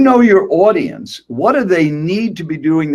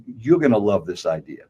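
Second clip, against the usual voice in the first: lower, softer promise to the listener; lower-pitched, quieter model line aimed at you; audience-focused phrasing; 7 semitones lower, 5 dB quieter than usual. Shown as a demonstration. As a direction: say it low and directly to one listener.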